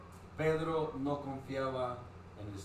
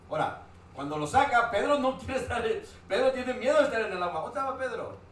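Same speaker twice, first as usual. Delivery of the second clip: louder, higher preaching emphasis; raised preaching voice; 8 semitones higher, 7 dB louder than usual. Acted. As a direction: drive the point home to the congregation.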